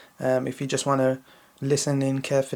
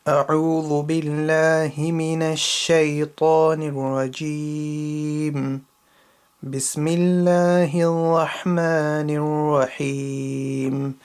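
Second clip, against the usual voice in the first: measured, melodic Quranic recitation; 4 dB louder than usual. Shown as a demonstration. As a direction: recite it with full tajweed, letting each phrase ring. Slower recitation for practice.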